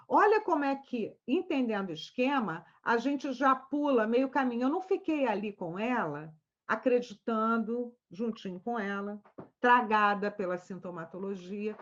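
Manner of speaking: ordinary talk, nothing marked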